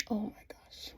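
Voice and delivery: breathless whispered gasp of shock. Real, not acted. Vlogger reacting to Shane's hit, shocked whisper. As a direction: whisper it like you can't believe it.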